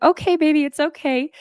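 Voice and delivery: put-on high, gentle soothing of a scared toddler; soothing mom. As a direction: coo it to calm a frightened child. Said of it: "so I'm like".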